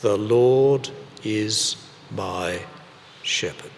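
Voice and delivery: slow, deliberate recitation for the congregation to follow. Shown as a demonstration. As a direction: lead the people in reciting, slowly. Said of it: "Let's do it again".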